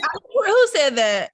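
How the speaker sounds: high, loud astonished question; real incredulous demand. Real, not acted. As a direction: demand the source in disbelief. Challenges what she was told.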